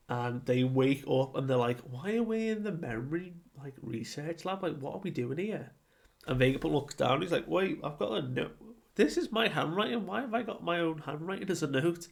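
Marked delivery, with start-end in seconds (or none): 1.8-5.7 confused. Retold or imitated. Confused characters waking in lab. 7.5-12.1 rising, puzzled and quicker; puzzled surprise. Retold or imitated. Vegapunk finds own note.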